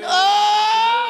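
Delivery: loud, high cry of worship; ecstatic shout. Real, not acted. Worshipper overwhelmed in church, loud ecstatic cry. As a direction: shout it out in praise.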